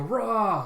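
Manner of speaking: loud, high "rah" war cry; acted rallying yell; samurai battle cry. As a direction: roar like troops charging off. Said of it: "samurai all taking off with a".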